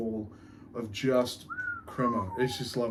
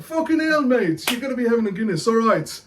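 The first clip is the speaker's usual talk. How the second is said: put-on Irish accent; 5 semitones higher than usual. Beer show host greets punters, hammed-up Irish accent. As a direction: put on a cheeky Irish brogue. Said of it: "keep this accent up".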